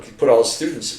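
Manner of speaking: ordinary talk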